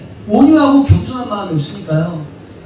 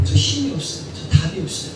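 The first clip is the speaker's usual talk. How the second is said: low, soft plea in prayer; helpless prayer; 7 semitones lower, 7 dB quieter than usual. Acted. Believer tells the Lord they have no strength, quiet and low. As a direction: own up quietly to having nothing left.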